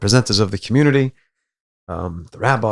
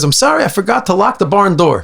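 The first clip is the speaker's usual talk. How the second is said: high, loud mock-sorry punchline; punchline apology; 10 semitones higher, 4 dB louder than usual. Third boy confessing to elders, high loud punchline. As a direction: deliver the twist as a raised apology. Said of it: "He goes".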